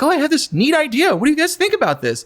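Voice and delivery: put-on high, eager, begging teenager voice; mock-needy teen plea for approval; desperate, thirsty teenager. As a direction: pitch it up like a kid begging for attention. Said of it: "desperate, thirsty teenager, you know, like, begging".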